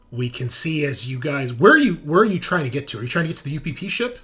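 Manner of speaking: ordinary talk, nothing marked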